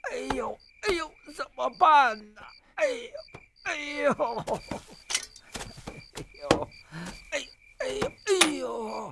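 pained moaning. Acted.

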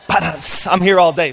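loud laughing aside. Real, not acted. Pastor laughing after his drug baby pun, loud. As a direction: laugh at your own joke.

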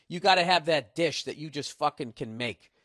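ordinary talk, nothing marked

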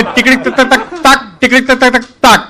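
clicking code-talk imitation; telegraph code chatter. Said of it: "તો ત્રીજો કે".

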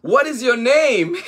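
high and laughing, then a squeal of a giggle; high baby hippo giggling her question; giggly question. Acted. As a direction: ask with delight, laughing. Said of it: "asked Himondo".

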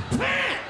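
high, shouted bam blast; a sudden explosion yelled out. Retold or imitated. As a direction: slam the word out like a blast. Mimics violent toilet blast.

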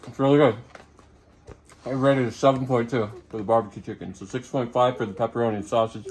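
chewing while talking; eating pizza between words; mouth full. Real, not acted. Seen 1: Holds bitten pizza; bites at +1.7, food visible in mouth later.

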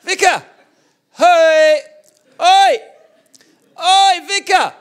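high, full-volume imitation of a pestering kid; really loud kid. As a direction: bellow it high to get the vicar's attention. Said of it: "I mean, really loud".

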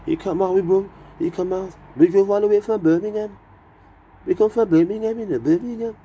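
high, loud mock Brummie voice; hammed-up Birmingham accent. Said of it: "I love Birmingham accent".

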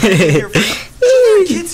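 very slow, loud, drawn-out yell; drawn-out shout. Acted. Customer bellows slowly at the Genius Bar clerk. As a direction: stretch it into a huge yell.

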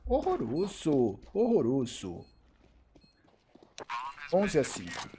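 ordinary talk, nothing marked

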